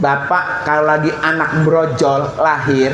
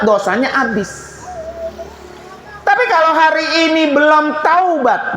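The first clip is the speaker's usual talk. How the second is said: ordinary talk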